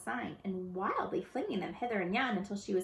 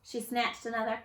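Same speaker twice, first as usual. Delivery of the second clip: ordinary delivery, nothing marked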